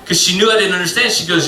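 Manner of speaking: usual speaking voice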